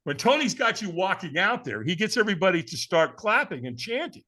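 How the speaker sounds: ordinary talk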